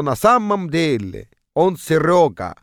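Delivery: slow imitated Estonian accent. Friend confesses who he really is, still in Estonian accent. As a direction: stretch the words in a mock Estonian accent. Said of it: "с таким же эстонским акцентом сообщил".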